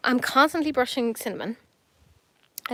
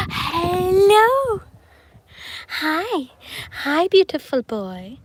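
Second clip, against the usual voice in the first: high, loud happy hello; delighted cat greeting; 7 semitones higher, 8 dB louder, much slower than usual. Real, not acted.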